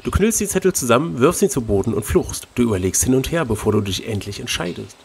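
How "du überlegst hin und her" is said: usual speaking voice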